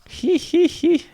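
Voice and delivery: very high nasal-free titter, sneering; high mocking giggle "he he he". A narrator's reading. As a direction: cackle up high at the brats. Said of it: "Nero said".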